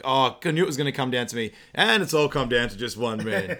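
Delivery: loud, fast nervous outburst; hammed-up dread, rushing the words; mock panicked player. Player watching the screen, acting out loud panic.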